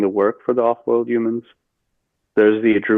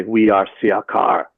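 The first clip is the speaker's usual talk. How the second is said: slow, lowered voice of a channeled alien being; a lowered, slow retelling of the being's name; voice goes down; slower than usual. Retold or imitated. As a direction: intone it slowly like an entity speaking through you. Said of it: "My voice goes down".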